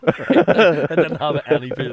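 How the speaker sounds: slow, loud laughing line; cracking up while admitting he doubted him. Real, not acted. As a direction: laugh the words out, high and loud. Laughing at own story.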